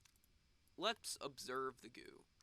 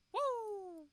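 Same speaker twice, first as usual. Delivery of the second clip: high, loud woo; excited whoop; 7 dB louder than usual. Real, not acted. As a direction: yell with glee. Cheering the rocket launch.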